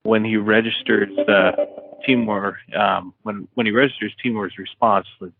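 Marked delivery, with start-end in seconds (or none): none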